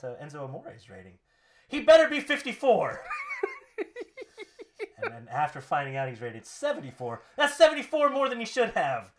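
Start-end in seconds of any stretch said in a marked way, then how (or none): 1.7-3.0 loud, high indignant outburst; scornful wrestler verdict; Rusev. Acted. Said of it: "Rusev, how do you feel about". 7.4-9.1 loud, higher scornful rant; wrestler's indignant put-down; Rusev. Acted. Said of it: "Rusev, how do you feel about".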